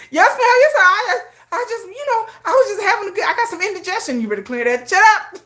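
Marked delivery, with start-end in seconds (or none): 0.1-4.2 high, laughing nervous backpedal; flustered excuse. Pretend. Caught critic blaming indigestion, high nervous laughing.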